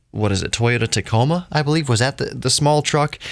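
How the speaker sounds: ordinary delivery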